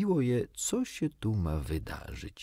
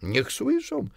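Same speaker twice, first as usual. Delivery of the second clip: high-pitched defiant retort; reckless; 4 dB louder, slower than usual. A narrator's reading. Husband defies his worried wife, reckless. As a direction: throw it back heedlessly, pitch up. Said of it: "odparł lekkomyślnie".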